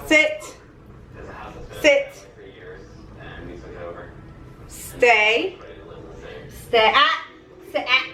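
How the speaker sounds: short stern commands; firm dog commands. Real, not acted. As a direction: tell the dog to sit. Training dog sit stay.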